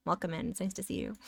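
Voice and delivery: soft greeting. Pretend. Streamer greeting the viewer she just scolded, softly.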